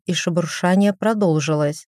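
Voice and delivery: ordinary delivery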